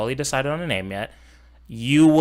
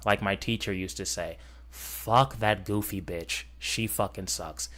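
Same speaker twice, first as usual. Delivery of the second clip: ordinary talk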